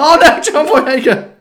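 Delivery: very high, loud, drawn-out hammed-up cry; mock wail. Lamenting the actor's failed date, mock wailing.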